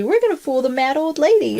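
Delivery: chirpy little puppy quote. Retold or imitated. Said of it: "you have the puppy".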